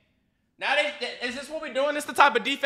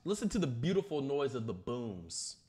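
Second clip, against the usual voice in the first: hushed savouring; 7 semitones lower, 7 dB quieter than usual. Real, not acted. Viewer savouring the crowd's reaction, hushed and low. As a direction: relish it, fading to very quiet.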